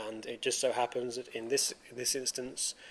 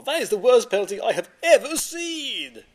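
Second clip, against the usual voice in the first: loud, high outraged commentator shout; aggressive commentator; 9 semitones higher, 8 dB louder than usual. Retold or imitated. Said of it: "aggressive commentary".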